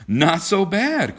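slow, high, delighted exclamation; enthusiastic cry. A narrator's reading. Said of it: "cried John enthusiastically".

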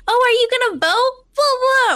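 high, mocking voice of a pushy campaign texter. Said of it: "And then they're like".

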